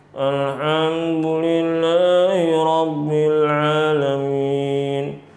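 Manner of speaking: very slow, dragging, drowsy recitation; sleepy recitation. Shown as a demonstration. Teacher imitating an imam reciting like he is half asleep, very slow. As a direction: drag out each word like a sleepy imam. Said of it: "kayak orang ngantuk".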